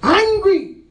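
high, loud preacher's shout; angry outburst. Shown as a demonstration. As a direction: burst out loudly on the word. Jekyll and Hyde contrast, loud side.

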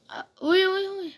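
high-pitched yelps of alarm; startled cry. Real, not acted. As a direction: react startled to a sudden attack. Startled by bombing in game. (चौंककर ऊँची आवाज़ में)

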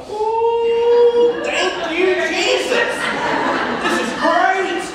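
high, sing-song relieved joy; a secret happy prayer, sung high; inner glee. Retold or imitated. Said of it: "on the inside I was going".

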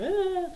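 high mock wail; short, high-pitched put-on "ah"; imitated crying scream. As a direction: mimic a child crying out. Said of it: "screaming and crying".

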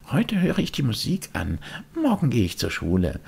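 put-on high, longing puppet boy; a boy pulled toward the music; wistful high boyish voice; Bengele's voice. Bengele deciding to skip school.

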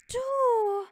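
high, loud, stretched-out yell; long accusing cry; acted furious shout. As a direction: explode at the other figure. Figure furiously accuses the other.

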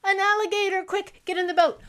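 high, loud pretend panic; hammed-up fright for kids; mock alarm.

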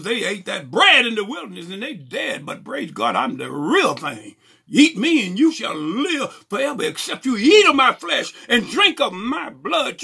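high, driving pulpit cry; full-force preaching. Acted. Preacher declaring the bread of life, full-force preaching. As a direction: press each phrase home, pitch climbing.

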